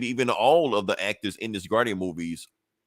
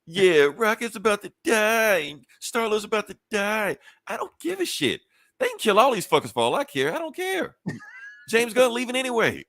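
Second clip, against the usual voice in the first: mock-callous whine, much higher; mock indifference; 10 semitones higher than usual. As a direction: shrug off every death in a put-on high voice. Mocks expected sad reaction.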